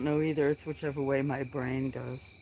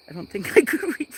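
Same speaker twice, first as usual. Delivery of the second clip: giggling through the line; laughing; 9 semitones higher, 9 dB louder, faster than usual. Real, not acted. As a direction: laugh while you say it. Laughing about not reading.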